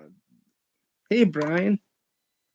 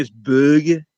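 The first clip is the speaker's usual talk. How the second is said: Brummie "burger", no r sounded; demonstrating a Brummie "buuhguh"; Birmingham accent; 4 dB louder than usual. Shown as a demonstration. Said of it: "if you're in Birmingham".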